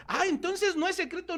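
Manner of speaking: high-pitched "ah, so that's it"; mock realization. Preacher voices a listener finding it is no secret, mock realization. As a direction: act out a listener suddenly catching on.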